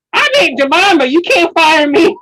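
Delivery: high, loud mock character wail; hammed-up sketch voice, dragged out at the end; Aunt Jemima. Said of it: "she had to do that voice".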